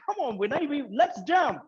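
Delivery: high-pitched imitation of an American woman; mock US accent. Skydiving instructor coaxing her student to jump, imitated US accent. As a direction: urge him on in a high, put-on American accent. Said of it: "in that U S accent, she's saying".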